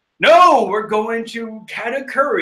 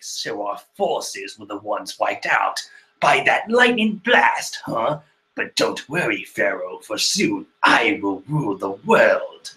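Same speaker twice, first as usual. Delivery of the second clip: lightly voiced villain; 9 semitones lower than usual. Voicing Katakuri as villain.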